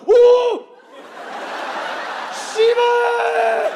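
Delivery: loud, high mock Korean cursing; guttural outburst. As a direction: explode in hammed-up disappointment, holding the last vowel. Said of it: "They're real guttural with it".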